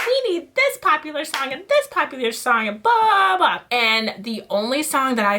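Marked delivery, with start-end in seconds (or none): 0.1-3.6 higher mock director voice, rising on the blahs; mocking Hilly. Mocking the director's song demands, higher. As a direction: lightly parody her demands. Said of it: "Hilly was like".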